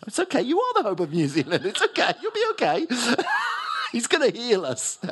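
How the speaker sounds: high, loud laughing fit; laughing so hard the voice cracks high; squealing with real laughter between words; helpless laughter. Real, not acted. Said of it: "Oh, sorry".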